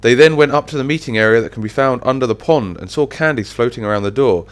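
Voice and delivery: plain reading voice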